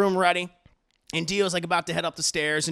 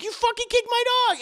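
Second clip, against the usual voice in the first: much higher outraged cry; outraged accusation; 4 dB louder than usual. Retold or imitated. JoJo telling his father, high outraged cry. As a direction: accuse him, voice cracking high.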